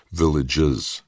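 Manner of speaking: VILLAGES set apart, stressing the plural ending; careful emphasis on "villages"; correcting emphasis. A narrator's reading. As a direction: correct yourself on "villages", sounding out each syllable. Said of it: "Brad corrected mentally".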